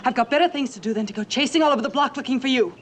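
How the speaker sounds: high, forceful telling-off; scolding mother. Acted. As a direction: lecture the child in exasperation.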